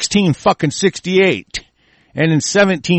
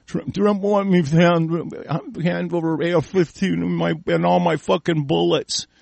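lightly mocking, stumbling follower voice; mocked Trump follower; 6 dB quieter than usual. Host imitates gun owner obeying Trump, mocking voice. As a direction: parrot it like a dim, obedient supporter. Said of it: "Hey, Trump wants you to".

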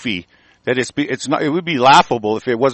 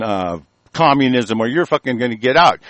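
ordinary talk, nothing marked

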